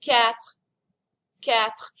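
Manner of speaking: "Quatre" like "cat" with a little French R at the end. Shown as a demonstration. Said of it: "a little bit of a French R".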